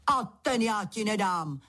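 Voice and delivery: crying out. A narrator's reading. King refuses Pedro his sword, crying out. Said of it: "vykřikl král".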